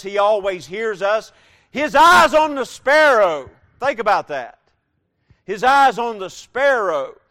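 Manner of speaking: fervent preacher crying out to the church; preaching at full force. Acted. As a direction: soar up on the sparrow line. Preaching climax.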